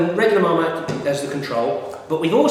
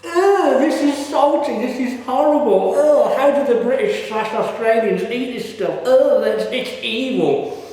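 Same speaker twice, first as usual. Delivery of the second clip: much higher, louder, slow hammed-up disgust; mock disgusted taster; 8 semitones higher, 4 dB louder, slower than usual. Vlogger mimics foreigners hating Marmite, loud high mock disgust. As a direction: recoil in exaggerated horror at the salt. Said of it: "they bite into it and they're like".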